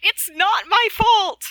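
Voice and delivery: loud, high, drawn-out protest; shouted protest. Acted. Player shouts back in acted quarrel.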